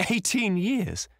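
high, stretched-out exclamation; exultant high-pitched echo; triumphant. A narrator's reading. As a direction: repeat it slowly, pitch soaring in triumph. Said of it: "with a touch of triumph in his voice".

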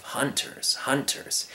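soft, savouring near-whisper; hushed, dreamy repetition; repeating to himself. Acted. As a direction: relish the sound of the word. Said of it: "over and over to himself".

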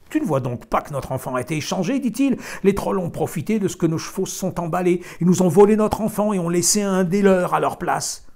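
the husband explaining, worked up; agitated farmer. A narrator's reading. Farmer voiced, building loud.

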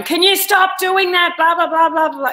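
put-on high, loud snapping at the kids; mock angry yell. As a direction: yell at the noisy kids to stop. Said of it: "rather than going".

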